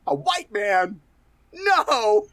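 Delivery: mock shocked yelp rising high; quoting the New Girl clip in a squeaky shocked voice; New Girl quote. Friend quoting a sitcom clip, high mock shock. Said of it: "that clip from New Girl".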